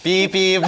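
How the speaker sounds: high loud beep-beep; sound effect censor. Pretend. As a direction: bleep out the answer. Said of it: "Запикали мы этот ответ".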